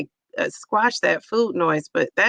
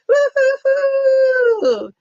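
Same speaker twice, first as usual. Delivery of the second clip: high, loud, drawn-out woo-hoo whoop; joyful scream; 5 dB louder, much slower than usual. Real, not acted. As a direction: scream for joy at the news. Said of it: "I am screaming".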